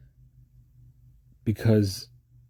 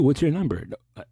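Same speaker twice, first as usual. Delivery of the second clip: ordinary talk, nothing marked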